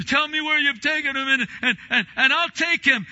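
high, loud, tumbling urgency; urgent plea. Retold or imitated. Mary begging to know where the body is, urgent and loud.

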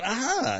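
high mock "ah" of pain; acted pained cry. Acting pain under the spray.

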